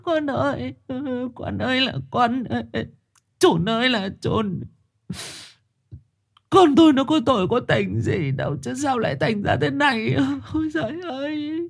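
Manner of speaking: wailing cry. Acted. Mother Thu wailing for her dead son. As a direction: wail for your lost son. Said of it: "bật khóc".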